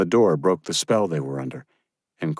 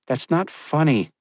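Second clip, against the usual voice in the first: higher, reproachful woman's voice; stern young woman; 9 semitones higher than usual. Acted. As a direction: rebuke him, not amused. Josephine rebuking.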